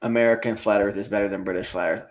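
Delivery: normal talking voice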